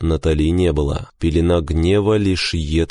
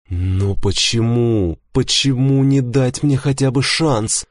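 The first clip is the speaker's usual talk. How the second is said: mildly angry, slow start then higher; angered protest; 7 semitones higher than usual. A narrator's reading. Rejected husband demanding a chance, mildly angry. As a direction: protest with a touch of anger, pitch climbing. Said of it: "разозлился я".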